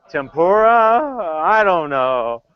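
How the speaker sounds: high, loud, very slow; doubtful guess. Acted. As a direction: draw out the question in comic doubt. Comic unsure guess.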